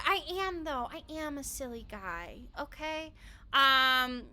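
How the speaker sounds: ordinary delivery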